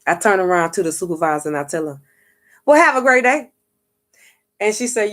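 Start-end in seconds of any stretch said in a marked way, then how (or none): 2.6-3.5 high, louder, breezy have-a-great-day; overly cheerful farewell. Retold or imitated. To the cussed-out supervisor, a loud, high, sunny goodbye. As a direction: kill the tension with sweetness. Defusing the fight with a pleasant farewell.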